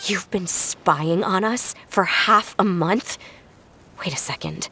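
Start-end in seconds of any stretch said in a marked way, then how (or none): 4.0-4.7 breathy whisper, caught by a thought; whispered realisation. Acted. Filiol to the family, a stunned whisper. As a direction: whisper it as the truth dawns. Sudden realisation mid-confrontation.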